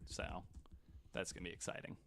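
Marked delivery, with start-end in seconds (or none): none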